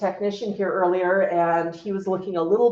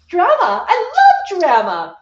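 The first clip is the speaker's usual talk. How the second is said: much higher, louder, gleeful cockatoo voice; bird's inner voice; 8 semitones higher, 8 dB louder, slower than usual. Retold or imitated. Cockatoo relishing the owner's fuss, hammed-up glee. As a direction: ham up a drama-loving parrot. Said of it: "the bird's gonna go".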